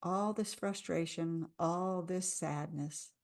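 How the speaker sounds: slow, softening into sadness; name the feeling softly; gentle sadness. Shown as a demonstration. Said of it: "I have a lot of sadness".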